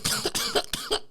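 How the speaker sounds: breathy squeal of a laugh; high squeaky laugh. Real, not acted. Comic telling the story laughing at himself. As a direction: laugh at his own story.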